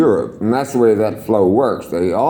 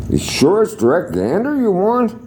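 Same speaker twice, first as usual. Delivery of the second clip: high incredulous check; 11 semitones higher than usual. Retold or imitated. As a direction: ask it high, doubting he means it. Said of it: "I said".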